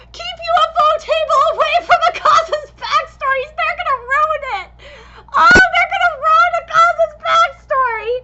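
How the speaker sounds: loud, high, half-laughing plea near tears; begging the studio in a crying rush; teary pleading. Real, not acted. Fan begging studio to spare Akaza's backstory, loud and teary. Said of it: "I'm so scared".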